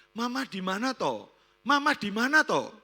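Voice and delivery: put-on high child's question, rising in force; daughter calling. Teenage daughter phones her absent mother, high insistent calling. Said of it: "Nah, anaknya".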